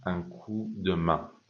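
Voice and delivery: very slow, word by word; slow model phrase. Shown as a demonstration. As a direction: spell it out slowly. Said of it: "donner un coup de main".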